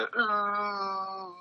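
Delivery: long, high held "ooh"; imitated song hook. Showing how the beat's sound went, high imitated ooh. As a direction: hum out a high, sustained ooh.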